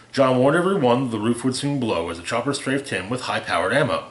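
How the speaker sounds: ordinary delivery, nothing marked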